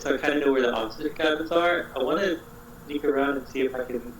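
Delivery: usual speaking voice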